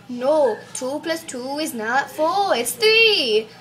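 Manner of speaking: loud mock-exasperated correction, rising at the end; exasperated teacher. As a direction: build to a loud teacherly outburst. Teacher angrily correcting a sum.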